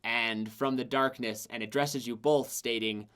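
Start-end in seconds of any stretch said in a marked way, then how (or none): none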